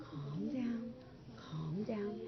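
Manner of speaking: calm yourself down quietly; teacher modeling soothing inner voice; soft soothing self-talk. Shown as a demonstration. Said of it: "what can we say inside your head instead?".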